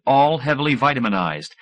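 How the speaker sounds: ordinary delivery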